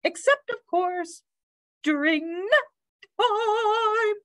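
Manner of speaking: high, trilling sing-song; bird teacher. A narrator's reading. Bird allows the dog nap time, high sing-song. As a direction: sing the exception high, holding the final words.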